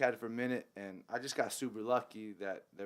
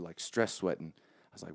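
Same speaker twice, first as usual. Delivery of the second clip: usual speaking voice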